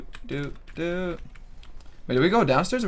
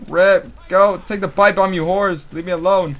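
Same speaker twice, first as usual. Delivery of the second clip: loud, raised yelling at zombies; loud gamer yell; 4 semitones higher, 8 dB louder, slower than usual. Real, not acted. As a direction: holler them away. Yelling at zombies while playing.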